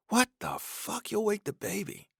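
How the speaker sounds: high, hushed, urgent scold; tired father hushing a yelling stranger; hissed rebuke. A narrator's reading. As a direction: scold him without waking the baby. Father hissing at loud stranger.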